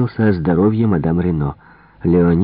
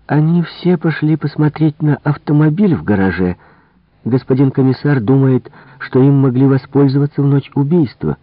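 higher, lively servant voice; helpful maid; 8 semitones higher than usual. Acted. Maid tells Poirot where everyone went, lively. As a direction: readily pass on the news.

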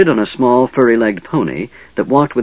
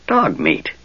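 croaked; 3 semitones higher than usual. Acted. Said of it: "the goblin croaked".

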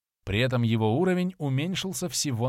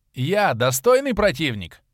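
loud, higher ringing challenge; 5 semitones higher, 6 dB louder than usual. A narrator's reading. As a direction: call it out across the arena. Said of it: "раздался знакомый голос". (громкий вызов)

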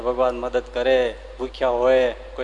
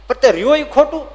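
much higher and louder; raised voice insisting; heated retort; 12 semitones higher, 8 dB louder than usual. Acted. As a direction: snap back in the argument. Argument continues.

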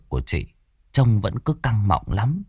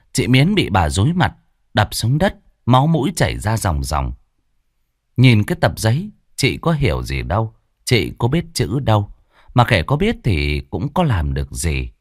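ordinary delivery, nothing marked

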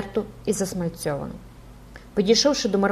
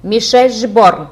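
loud, slow, drawn-out call; shout into the ear; 3 semitones higher, 10 dB louder, slower than usual. A narrator's reading. The stranger calls Jupiter by his real name, shouting. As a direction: shout the name to rouse someone. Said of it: "крикнув йому мало не в саме вухо".

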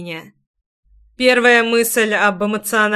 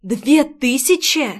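slow, higher, incredulous question; astonished gasp; 4 semitones higher, slower than usual. A narrator's reading. Girl seeing the sum on her bill, gasping in astonishment. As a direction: stretch it out in amazement. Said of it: "ахнула Майри".

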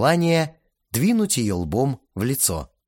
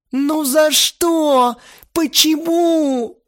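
high, loud, very slow crying; sobbing wail; 7 dB louder, much slower than usual. A narrator's reading. Lena sobs to Vova, high and drawn out. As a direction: drag out the words through sobs.

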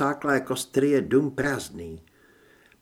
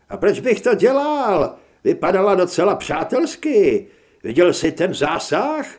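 louder, higher, animated character voice; excited retort; 6 semitones higher, 6 dB louder than usual. A narrator's reading. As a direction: fire back loudly, thrilled by the shot. Aino answers, thrilled by the shot. (vzrušeně a hlasitě)

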